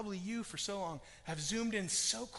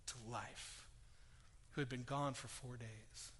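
ordinary talk

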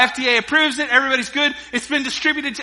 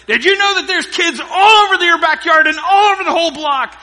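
loud, high angry shout; irate shout; 9 semitones higher, 10 dB louder than usual. Retold or imitated. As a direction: yell it like a furious neighbor on the phone. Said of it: "he is irate".

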